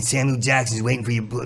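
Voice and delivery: ordinary delivery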